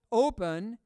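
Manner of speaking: emphatic, set-apart Open; stress on Open. Real, not acted. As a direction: Open punched out loud. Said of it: "I'm emphasizing that word open".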